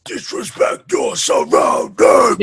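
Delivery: harsh breathy scream, slow; screamo scream. Retold or imitated. Echoing the band's breakdown scream, screamed. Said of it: "that's how they said it".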